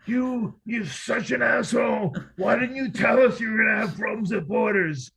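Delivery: high, loud, furious yelling at a friend; furious shout. Retold or imitated. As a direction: scream it out, outraged and betrayed. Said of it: "He was so fucking pissed off".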